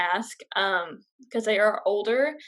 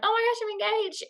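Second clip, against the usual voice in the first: much higher, thrilled squeal of news; excited announcement; 12 semitones higher than usual. Retold or imitated. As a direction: burst out the news to a friend, giddy. Calling friends with big news.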